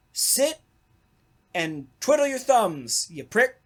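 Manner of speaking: ordinary delivery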